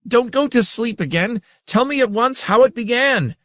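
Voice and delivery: very high, loud, shrill demand. A narrator's reading. Saren grabs the grandmother and shrills at her to tell. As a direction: shriek at her to stop. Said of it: "Saren shrilled".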